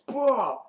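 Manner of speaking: high, loud falling impact noise; vocal sound effect of a big whack; imitated rake blow. Andrea swings the rake at the zombie, imitated blow. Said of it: "takes the rake and goes".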